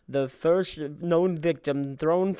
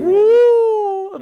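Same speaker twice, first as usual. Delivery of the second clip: high ghostly woo; long high ghost hoot; mock spooky woo; 8 dB louder than usual. Spooky zombie joke.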